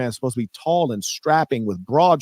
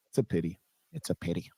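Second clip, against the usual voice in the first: quiet mock pity; 7 semitones lower, 11 dB quieter, faster than usual. Guest taunts quietly.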